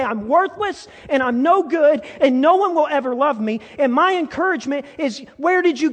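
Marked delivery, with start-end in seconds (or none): 0.0-3.6 despairing inner voice. Retold or imitated. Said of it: "you say".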